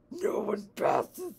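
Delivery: slow, rough-whispered monster voice; deep, gruff. A narrator's reading. Chain chomp blocks the travelers' way, deep gruff tone. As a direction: growl it slowly, like a gruff beast. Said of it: "with a deep and gruff tone".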